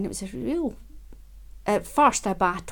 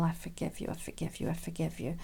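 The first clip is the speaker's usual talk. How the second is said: much softer, fading to a breathy near-whisper; soft forgiving murmur; 3 semitones lower, 9 dB quieter than usual. Shown as a demonstration. Repeating forgiveness to herself, hushed and tender. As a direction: let each I forgive you sink in gently. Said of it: "you can just say it".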